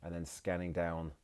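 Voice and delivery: calm, measured meditation voice with long pauses; guided meditation. Acted. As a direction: guide them gently into relaxation, phrase by phrase. Leads a mindfulness body scan.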